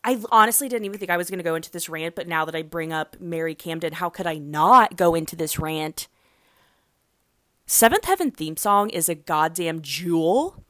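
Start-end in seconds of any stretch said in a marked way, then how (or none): none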